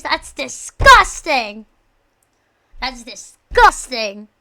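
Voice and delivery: slow, loud hammed-up disgust; mock disgust. Streamer reacting to a British viewer, mock disgust. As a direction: drag it out, then explode on GUSTING.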